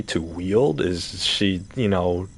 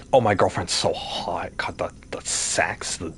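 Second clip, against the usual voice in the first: mock whisper. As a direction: whisper it like a smug secret. Mocking bragging about girlfriend.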